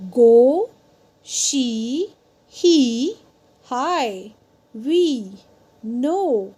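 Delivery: each word alone and slow, as in "go", "she", "he", final vowel held; long open vowels. Shown as a demonstration. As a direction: stretch the open vowels, splitting "tomato" and "potato" into syllables. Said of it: "let us try some more words".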